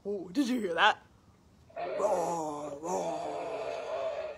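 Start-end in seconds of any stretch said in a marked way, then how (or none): 2.0-4.4 low, drawn-out bear roar; imitated bear roar. Echoing the polar bear for children, low roar.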